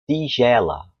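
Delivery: Northeastern "tigela" with hard t, "tee-jela"; Northeastern Brazilian accent. Shown as a demonstration. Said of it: "quem mora no Nordeste".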